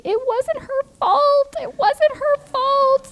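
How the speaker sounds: put-on high, slow, wailing little girl voice; imitating screaming child. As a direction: wail it like a sobbing kid defending her sister. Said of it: "And she's screaming".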